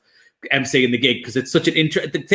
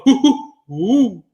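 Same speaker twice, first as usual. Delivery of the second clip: slow, higher mock monkey hoots; monkey noises; 7 semitones higher, 3 dB louder, much slower than usual. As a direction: hoot slowly like a monkey. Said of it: "where people go".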